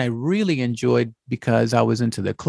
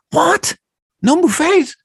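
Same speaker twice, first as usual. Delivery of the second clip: high, loud incredulous squeal; outraged surprise at the news; shocked disbelief; 4 dB louder, slower than usual. Retold or imitated.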